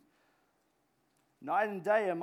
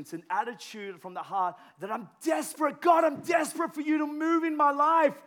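higher and far louder cry to God; desperate preaching shout; 9 semitones higher, 9 dB louder than usual. Real, not acted. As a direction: preach it with everything. Preaching desperation at full force.

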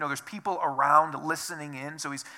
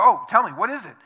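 eager curiosity; 4 semitones higher, 10 dB louder than usual. Pretend. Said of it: "we'd probably go".

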